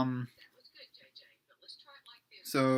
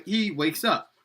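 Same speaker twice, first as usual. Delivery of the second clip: plain reading voice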